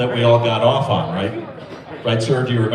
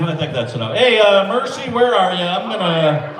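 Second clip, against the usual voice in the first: normal talking voice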